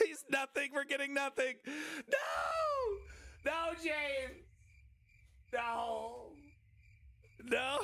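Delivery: high shouted no, half laughing; anguished denial. Real, not acted. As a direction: wail no in mock despair. Begging chat viewer James.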